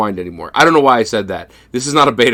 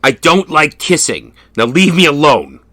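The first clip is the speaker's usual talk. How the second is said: louder, curt refusal breaking into laughter; gruff refusal; 6 semitones higher, 6 dB louder than usual. Retold or imitated. Detective rebuffing his secretary, louder mock curt refusal. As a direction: brush her off, mock tough. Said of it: "And he goes".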